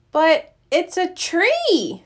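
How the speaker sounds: slow, high, amazed; drawn out in disbelief; surprised cub. A narrator's reading. Brother Bear to Papa, surprised at the tree house.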